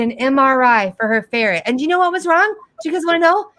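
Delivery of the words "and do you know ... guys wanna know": ordinary delivery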